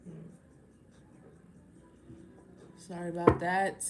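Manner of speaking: ordinary talk, nothing marked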